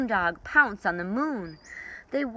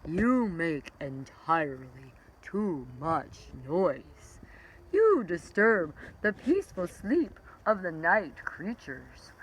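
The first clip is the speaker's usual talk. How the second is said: slow, drowsy sloth voice; lightly voiced sloth scolding the parrot, slow; sleepy sloth; slower than usual. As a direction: drag the words like a sleepy sloth. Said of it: "The sleepy-eyed sloth had a talk".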